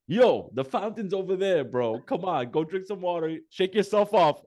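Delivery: high, pushy coaching voice; tough-love uncle. Retold or imitated. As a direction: urge him on like a gruff uncle. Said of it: "Mikey's like".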